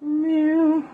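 high playful meow; high mock meow; cat meow. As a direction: meow like a kitten. Cat noise to viewers.

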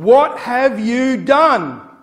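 slow, loud, high cry of shock; shocked mum. Retold or imitated. Mum catching the kids eating chocolate, shocked shout. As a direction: drag out each word in outrage. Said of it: "she's gone".

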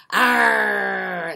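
loud pirate arr; pirate growl. Pretend.

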